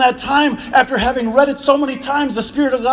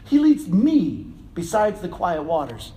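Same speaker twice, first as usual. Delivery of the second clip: ME held out, the rest dropping lower; stress on ME; 5 semitones lower, 4 dB quieter than usual. Shown as a demonstration. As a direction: lean on "me" as deeply personal, then pause. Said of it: "just the slight inflection on that".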